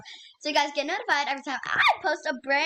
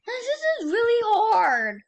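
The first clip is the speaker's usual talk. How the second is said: higher, slow, teary complaint; teary whine; 7 semitones higher, 4 dB louder than usual. Real, not acted. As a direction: stretch it out, almost crying. Struggling with the level.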